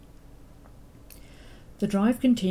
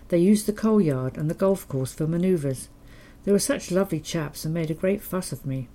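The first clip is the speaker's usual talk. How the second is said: usual speaking voice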